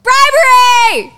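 high, long-held announcing yell; very loud shout. Real, not acted. Cohost announcing favourite podcast segment, very loud drawn-out shout. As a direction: belt it out and hold it. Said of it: "You say I'm too loud".

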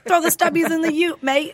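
put-on Australian accent. Co-host joining the accent game, mock Australian accent. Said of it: "It's Australian".

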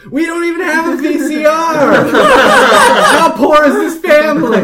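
shouted high and laughing in disbelief; incredulous shout. Retold or imitated. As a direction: yell it, cracking up. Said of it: "and I went".

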